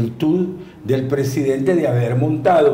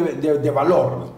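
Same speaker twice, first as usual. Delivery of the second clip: ordinary delivery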